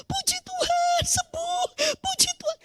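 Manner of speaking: high, soft, tearful joy; breathless happy praise; overjoyed praise, high and hushed; joyful praise. Retold or imitated. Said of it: "Saya jingkrak".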